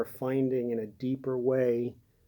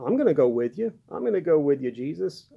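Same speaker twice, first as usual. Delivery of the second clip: louder, insistent pledge, pressing each promise; eager vow; 4 semitones higher, 7 dB louder, faster than usual. Acted. Peter pledging to follow Jesus, eager and emphatic. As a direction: promise it loudly, all eagerness.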